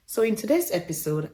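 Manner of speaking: ordinary talk, nothing marked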